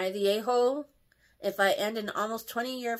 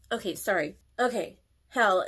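ordinary talk, nothing marked

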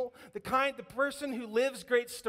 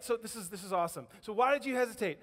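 ordinary delivery

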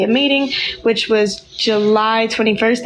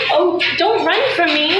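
higher pleading coo to a pet; 5 semitones higher than usual. Real, not acted. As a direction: plead sweetly with the dog. Dog runs from her.

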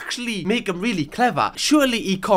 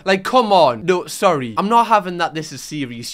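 normal talking voice

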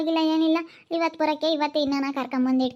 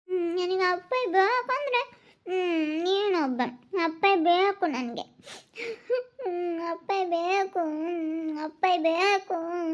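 softer, sniffling pleas; soft whimpering; 4 semitones higher, 4 dB quieter, much slower than usual. Acted. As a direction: whimper it, almost cried out. Girl whimpering quietly.

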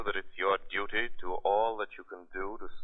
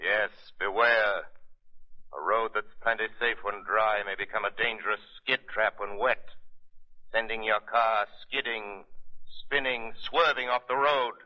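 scare drivers about wet roads; mock-sinister commercial read; dramatic ad warning; 3 semitones higher than usual. Shadow voice reads ad warning.

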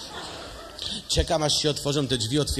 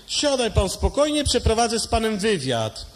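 bossy doctor; 7 semitones higher than usual. Acted. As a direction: order him to sit like a pompous doctor. Doctor orders. (nadęty lekarz, wysoko)

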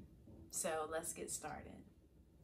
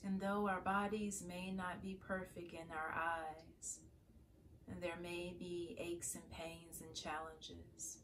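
calm, softer meditation voice with long pauses; guided meditation; 7 dB quieter than usual. Acted. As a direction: guide listeners gently into prayerful stillness, leaving space to breathe. Leads a morning meditation.

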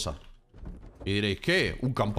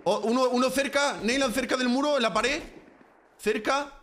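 excited callouts; 7 semitones higher than usual. Real, not acted. Calling enemy positions.

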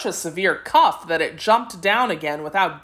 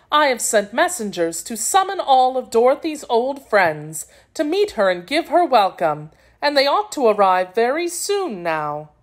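princess; 6 semitones higher than usual. A narrator's reading. Princess's dialogue in story.

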